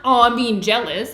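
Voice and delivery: loud, swept-up mock reaction; caught in jealousy. Shown as a demonstration. Someone pulled down into jealousy, loudly reactive. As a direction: blurt it out as if consumed by it.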